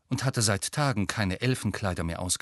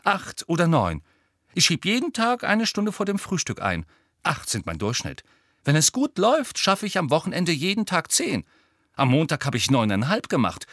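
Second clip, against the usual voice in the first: eager, puffed-up voice leaping high and loud; boastful; 5 semitones higher, 4 dB louder than usual. A narrator's reading. Ernie bragging about his daily study hours, boastful. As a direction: rattle off the tally self-importantly. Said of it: "warf sich in die Brust".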